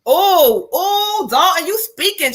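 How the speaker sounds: high, excited recognition. Real, not acted. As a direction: greet the spirit with delight. New spirit arrives.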